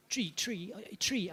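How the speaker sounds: lightly imitated Irish co-driver calling pace notes; Irish co-driver impression. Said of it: "He's going".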